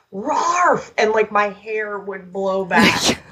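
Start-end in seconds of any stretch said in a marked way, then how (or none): 0.1-0.9 a short, higher mock dog bark; imitated booming bark. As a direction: bark it once like a big dog. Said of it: "I used to envision that he would go".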